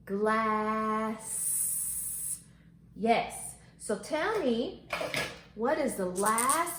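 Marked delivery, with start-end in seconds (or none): 0.1-1.3 GLASS stretched very long with a held hissing S; slow-motion Glass on a level tone; stretched-out word. Shown as a demonstration. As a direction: stretch Glass so every sound is heard. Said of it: "Let's stretch it out".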